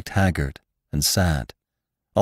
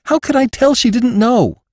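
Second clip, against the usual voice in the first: much higher, louder anxious plea; distressed Jane; 5 dB louder than usual. Acted. Jane pleads her innocence to Venters, high and louder. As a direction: cry out in helpless defense.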